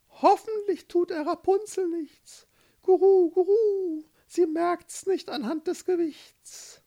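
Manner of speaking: very high cooing bird voice; anxious pigeons. A narrator's reading. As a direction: fret and coo up high like a pigeon. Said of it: "beobachteten die Szenerie ängstlich". (ängstliche Taube, sehr hohe Stimme)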